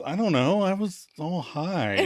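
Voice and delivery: mock stoner excuse, stretched out; trivializing. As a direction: brush it off goofily. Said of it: "[name] trivializes".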